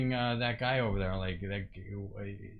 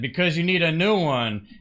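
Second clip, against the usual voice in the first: mild mock yell, louder and higher; retold yell; 4 semitones higher, 8 dB louder than usual. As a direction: imitate a stranger yelling at you. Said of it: "started yelling at me".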